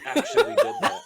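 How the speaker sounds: high, loud laughing line; cracking up at a friend's sound effect. Real, not acted. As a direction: barely get the words out through laughter.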